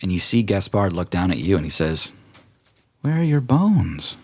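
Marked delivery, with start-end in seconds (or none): 3.0-4.2 high slow ghostly question; slow eerie rider. Acted. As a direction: ask it slowly, otherworldly. Gaspard speaks from horse.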